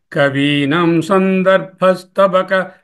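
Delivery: slow, drawn-out chanting of the Sanskrit line; slow verse recitation. Shown as a demonstration. Teacher reciting a verse to students, slow and chanted. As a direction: intone each syllable as scripture.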